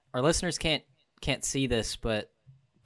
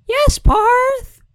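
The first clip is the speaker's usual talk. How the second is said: very high, loud mock squeal of agreement; hammed-up, piercingly high impression of an eager friend; exaggerated friend imitation; 15 dB louder than usual. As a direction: imitate the friend with an over-the-top high shout. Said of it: "That's my imitation".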